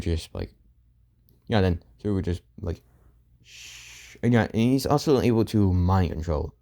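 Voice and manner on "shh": a breathy, voiceless shh; whispered shush. Retold or imitated. Suku silences the shocked morgue staff with a whispered shh. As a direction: hush them with just breath.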